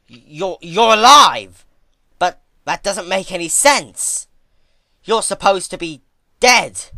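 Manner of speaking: loud, high, stammering shock; panicked disbelief. Acted. Twilight panicking at Celestia coming back to life. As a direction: blurt it out, horrified and stammering.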